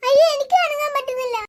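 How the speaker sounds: family frozen by the ghost, crying out in terror; terrified cries. Acted. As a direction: cry out in fear, frozen.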